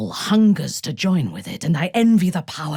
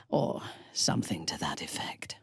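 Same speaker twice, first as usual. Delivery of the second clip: much lower, soft wry whisper; 11 semitones lower, 11 dB quieter than usual. Acted. Muttering aside to companions, whispered. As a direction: throw it away under the breath.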